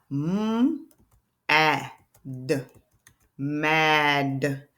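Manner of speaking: segmented sounding out, stretching each sound. Shown as a demonstration. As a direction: tap out v, ah, f slowly. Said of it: "That's a real word".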